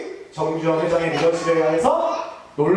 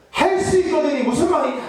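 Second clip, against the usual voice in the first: rebuking Jesus; 6 semitones higher than usual. Retold or imitated. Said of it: "라고 꾸짖으시는 거예요".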